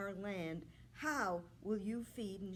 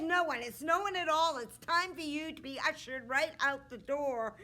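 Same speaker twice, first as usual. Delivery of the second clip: higher, louder impatient king voice; a king showing the princess out; grumpy king; 5 semitones higher, 8 dB louder than usual. Acted. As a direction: hurry her out like a crabby old king. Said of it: "king with a terrible temper".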